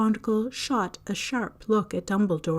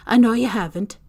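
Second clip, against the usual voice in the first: half exasperated; 2 semitones higher, 8 dB louder than usual. A narrator's reading. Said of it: "sounding half exasperated, half admiring".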